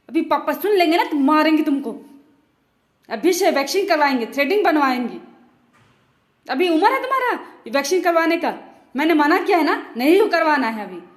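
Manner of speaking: loud nagging mom tirade; scolding mother. Acted. Fed-up mother yelling at her children, loud scolding. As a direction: yell at the lazy kids like a fed-up mother.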